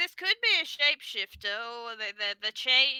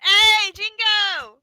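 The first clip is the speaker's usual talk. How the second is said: loud, slow drawn-out hail; calling out; 5 semitones higher, 11 dB louder, much slower than usual. Acted. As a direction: hail him across the tavern. Calling out across tavern.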